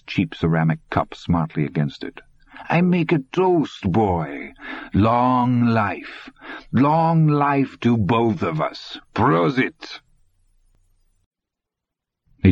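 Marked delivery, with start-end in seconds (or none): 2.7-10.0 put-on higher, slow, ceremonious accented toast; old man toasting the trapped boy; mocking old German toast. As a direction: raise the glass with grim irony.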